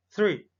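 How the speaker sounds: "three" with a soft, light TH; "three" with a weak TH sound. Shown as a demonstration. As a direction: breathe the TH in "three" gently. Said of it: "three, es un sonido débil".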